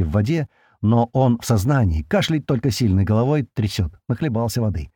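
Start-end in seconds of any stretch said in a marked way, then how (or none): none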